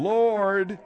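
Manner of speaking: John Huston. Retold or imitated. Host mimicking what Margaret just moaned, John Huston style. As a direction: stretch one word out in imitation. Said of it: "sounded like John Huston in Chinatown".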